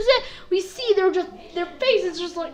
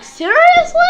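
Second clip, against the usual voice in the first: loud, high-pitched disbelief; incredulous outburst; 13 semitones higher, 11 dB louder than usual. Retold or imitated. Kaioshin reacts to his fighter's loss, loud and incredulous. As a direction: shoot up in mock disbelief.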